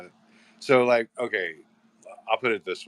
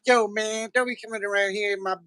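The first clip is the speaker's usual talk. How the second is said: very high, fast squeaky gangster voice; nerdy gangster voice; 13 semitones higher than usual. Retold or imitated. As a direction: play a dying nerdy gangster, high and quick.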